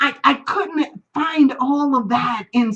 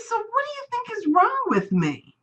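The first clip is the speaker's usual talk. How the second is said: raised, tentative "what is wrong with me"; high anxious question; 10 semitones higher than usual. Retold or imitated. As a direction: ask timidly. Her earnest question, high.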